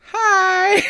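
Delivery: put-on bubbly high Valley Girl hi; chirpy high-pitched Valley Girl greeting; Valley Girl accent. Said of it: "almost Valley Girl accent".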